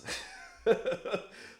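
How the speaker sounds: high breathless smug laugh; airy giggle after boasting. Real, not acted. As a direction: laugh at your own brag. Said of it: "Because I'm always right".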